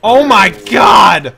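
loud, slow, drawn-out shout; shouted disbelief. Real, not acted. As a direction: drag it out at full volume. Reacting to a shot.

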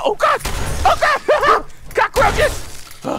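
very high, loud yelp with a laugh; panicked squeal. Pretend. Player finding cockroaches in a game, panicked squeal. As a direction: squeal in mock horror at bugs.

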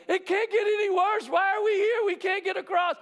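panicked Israelites. Retold or imitated. Israelites panic at Red Sea.

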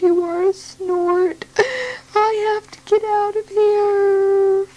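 high, slow, crying little bird voice; a tiny bird near tears, pleading to get away; frightened baby bird. Acted. Baby bird cries to the snort in fright.

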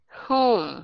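Home said with a nasal long O, pushed into the nose; nasalized home. Shown as a demonstration. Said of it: "Âm mũi".

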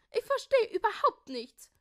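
very high, loud bird-like voice; chirping. A narrator's reading. Rosalinde puzzled by the bag, chirping. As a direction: chirp it in high-pitched bewilderment. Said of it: "zwitscherte Rosalinde".

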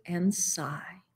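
whispered softly like a sighing wind. A narrator's reading. Narrator lulls the napping pair, whispering. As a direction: breathe it out as they drift off. Drowsy nap, soft wind.

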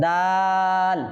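the vowel of দাল held long; drawn-out Dal. Shown as a demonstration. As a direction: stretch দাল slowly like a recitation teacher. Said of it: "টেনে পড়তে হবে". (দাল শব্দটি টেনে লম্বা করে পড়া)

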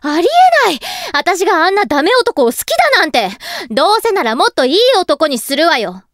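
flustered denial. Acted. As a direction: deny the crush vehemently. Flustered denial of her crush.

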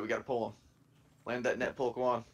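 ordinary talk, nothing marked

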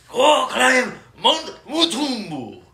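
slow, high hammed-up accent mashup; mixed Chinese accent. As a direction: drag it out in a clashing accent.

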